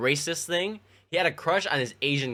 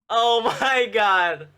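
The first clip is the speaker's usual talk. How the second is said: drawn-out amazement; 7 semitones higher, 5 dB louder, much slower than usual. Real, not acted. Reacting to the funny memory.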